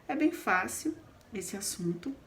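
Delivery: ordinary delivery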